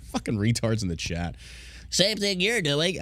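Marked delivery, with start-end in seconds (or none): 1.9-3.0 higher, slow, sneering mimic; mocking commenter. Mocking a commenter on his Facebook, slow and high. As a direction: parrot it back like a dumb troll. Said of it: "Somebody said".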